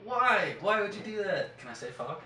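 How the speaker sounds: ordinary delivery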